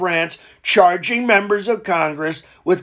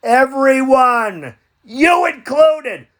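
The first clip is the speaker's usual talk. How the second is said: mock shout; 6 semitones higher, slower than usual. Mock senator outburst.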